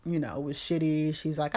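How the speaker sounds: ordinary delivery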